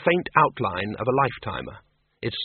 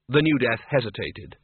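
usual speaking voice

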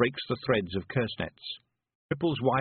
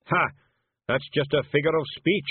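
louder scornful snap; snapped; 5 semitones higher, 5 dB louder than usual. A narrator's reading. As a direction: dismiss her with contempt. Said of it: "snapped Zak Zak".

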